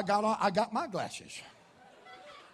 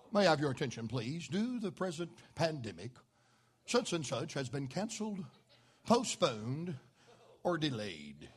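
much lower and softer, announcer style; mock announcement; 5 semitones lower, 7 dB quieter than usual. Preacher mocking a cancellation notice, low and soft. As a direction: deliver it like a dry public notice. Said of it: "what you ain't never gonna hear".

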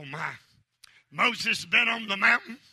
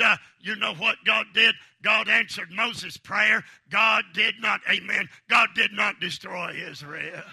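ordinary delivery

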